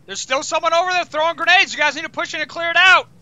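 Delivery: high, loud combat commands; urgent shouted orders. Real, not acted. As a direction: push the squad forward, yelling. Urging squad under grenade fire.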